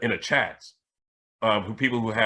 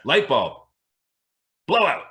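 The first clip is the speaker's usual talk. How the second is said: "blow out" pushed higher and louder; louder insistent command; 4 semitones higher, 5 dB louder than usual. Retold or imitated. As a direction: demand it with force this time.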